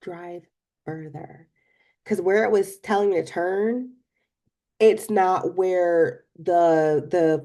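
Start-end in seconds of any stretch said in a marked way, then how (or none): 0.0-1.5 soft, hushed divine voice; voice of God. Retold or imitated. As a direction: speak as God, quietly and calm. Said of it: "God just spoke to me, and he was like".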